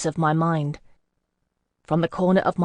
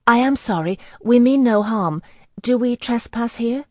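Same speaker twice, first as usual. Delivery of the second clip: high, loud and urgent apology; anxious pleading; 6 semitones higher, 5 dB louder than usual. Acted. Woman begging a scout leader's pardon, high and anxious. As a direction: plead fast to calm armed riders.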